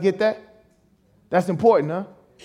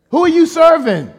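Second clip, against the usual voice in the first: loud, higher pointed question; loud challenge; 9 semitones higher, 7 dB louder than usual. Real, not acted. Preacher challenging the congregation, loud and high. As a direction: hit it hard at the congregation.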